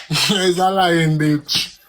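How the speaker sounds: laughing. Real, not acted. Laughs while calling her liar.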